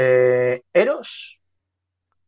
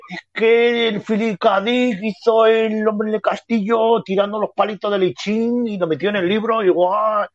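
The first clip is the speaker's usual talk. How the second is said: mock bragging; 5 semitones higher than usual. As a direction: parody a show-off, stretching the words out high. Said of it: "se echan el pegote".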